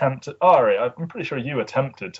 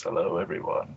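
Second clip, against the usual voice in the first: faint, low voice as if nearly passed out; barely there, drained of strength; a limp, near-fainting hello; 7 semitones lower, 9 dB quieter than usual. Shown as a demonstration. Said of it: "doing this voice".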